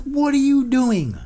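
high, loud, slow exasperated yell; mock yelling. Ranting at passengers lining up early, exasperated yell. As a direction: yell it at them again.

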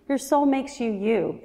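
ordinary delivery

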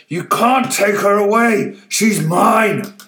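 put-on old man screaming after the girl; possessive scream. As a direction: shriek it as he gives chase.

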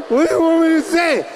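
put-on desperate kid shout. Kid snapping back at mom.

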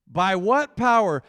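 slow, loud booming question; loud repeat. Shown as a demonstration. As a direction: bellow it again, drawn out. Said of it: "I wanna say that again".

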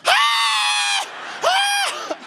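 piercing high imitation baby scream; newborn's cry. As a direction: wail back at the parents like a newborn. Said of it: "And they look at us and go".

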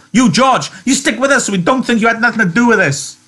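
high, loud threatening call; Curly calling. Acted. Curly calls George to come along, threatening. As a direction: warn him across the barn. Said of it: "until Curly called".

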